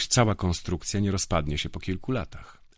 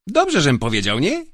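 higher, louder self-satisfied question; smug drunk; 9 semitones higher, 8 dB louder than usual. Acted. Drunk guard seeking Grifo's approval, loud and smug. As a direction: fish for praise, pleased with himself.

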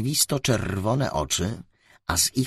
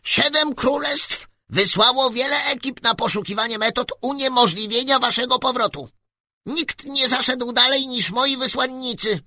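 very high, loud squeak through a megaphone; squeaky little voice; 6 dB louder than usual. Acted. Tiny ruler greets the travellers through a megaphone, very high squeaky voice.

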